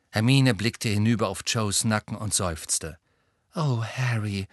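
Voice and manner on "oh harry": sad. Acted. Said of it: "sagte sie traurig".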